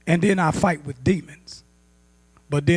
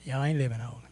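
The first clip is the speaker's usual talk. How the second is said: low, soft dismissive mimicry; doubters' grumbling; 5 semitones lower, 6 dB quieter, faster than usual. Doubters excusing sin to holiness people, low grumbling. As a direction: imitate sceptics grumbling low and quiet. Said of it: "They say".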